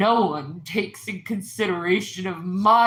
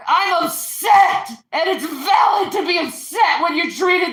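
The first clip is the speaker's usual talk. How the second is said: furious shouting; 5 semitones higher than usual. Real, not acted. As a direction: shout every grievance at him. Shouting grievances in argument.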